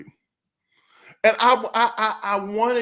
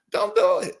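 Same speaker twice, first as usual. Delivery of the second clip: high mock warning; 3 dB louder than usual.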